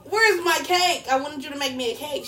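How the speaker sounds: loud, high-pitched mock outrage; playfully outraged complaint, starting at a near yell; indignant retold confrontation. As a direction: demand it back, hammed up. Acted confrontation with grandma over cake.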